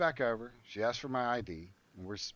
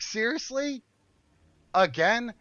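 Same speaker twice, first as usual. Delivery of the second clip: much higher, incredulous Seriously?; exasperated disbelief; 10 semitones higher, 6 dB louder, slower than usual. Retold or imitated. To the receptionist rolling her eyes again, incredulous. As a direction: throw it back in sharp disbelief. Disbelief at another eye roll.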